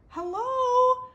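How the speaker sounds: very high, loud shouted hello; shouting. Acted. Abel shouting for Beatrice in the pile, loud. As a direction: shout out anxiously. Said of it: "Abel shouted".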